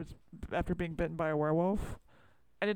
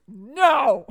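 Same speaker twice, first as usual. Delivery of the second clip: re-enacted shout, much louder and higher; retold loud refusal; 5 semitones higher, 14 dB louder than usual. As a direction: shout down the idea in mock rejection. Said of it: "You were vulnerable and I was like".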